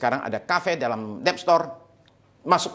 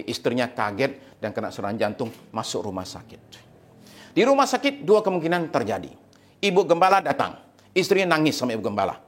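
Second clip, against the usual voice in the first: ordinary talk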